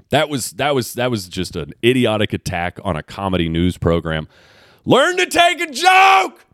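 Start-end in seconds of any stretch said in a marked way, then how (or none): 4.9-6.4 high, slow yell at the critic; angry shout. Real, not acted. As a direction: bellow it out, fed up. Heated rant against the article.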